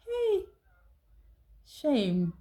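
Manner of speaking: mock shocked hey. Real, not acted.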